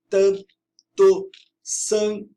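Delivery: "tanto sangue" broken into separate syllables with long pauses; syllable-by-syllable scansion. Shown as a demonstration. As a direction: count out "tan, to, san, gue" one by one. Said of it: "fazer a escansão do verso". ("tanto sangue" escandido, sílaba por sílaba)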